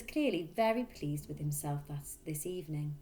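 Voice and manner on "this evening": ordinary delivery, nothing marked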